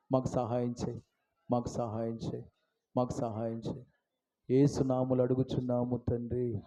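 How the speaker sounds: very soft, low plea; quiet, low supplication winding down; hushed closing prayer. Real, not acted. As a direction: murmur the repeated plea to God. Closes the prayer softly.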